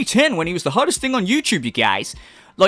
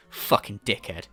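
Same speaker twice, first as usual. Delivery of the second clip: ordinary talk, nothing marked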